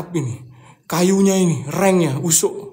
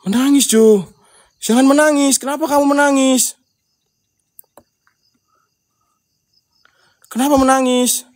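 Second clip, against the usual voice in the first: high and loud, calling into the dark; loud high call to a crying voice in the house; high anxious call; 9 semitones higher, 7 dB louder than usual. Real, not acted. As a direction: call out to the crying you can't see. Calling to an unseen crying child.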